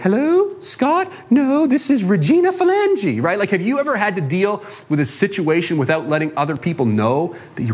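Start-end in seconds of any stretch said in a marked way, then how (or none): 0.0-3.2 high, put-on fake voice dodging a caller; masked phone voice. Preacher dodging a debt collector on the phone, high fake voice. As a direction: pitch the voice way up to pass as someone else. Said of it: "answer the phone and mask my voice".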